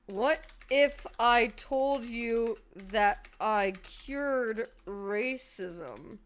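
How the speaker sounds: very slow, dropping, deadpan delivery. Pretend. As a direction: drag it out for the joke.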